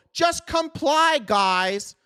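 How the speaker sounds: pleading with the rebels to give in, mocking whine; mock loyalist. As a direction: drag out a sneering mock plea to obey. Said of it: "you go".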